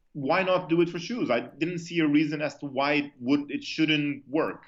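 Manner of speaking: usual speaking voice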